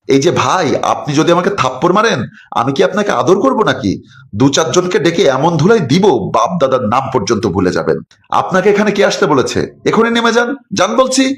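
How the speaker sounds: rising high angry voice, climbing to a yell; young man telling the husband to get out; angry threat. Acted. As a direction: push him off the roof with threats.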